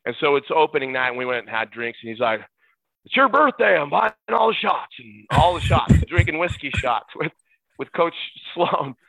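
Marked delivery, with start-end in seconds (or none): 3.0-4.9 high, loud celebratory offer; retold generous announcement. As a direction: announce a round for the birthday. Retold coach treating him.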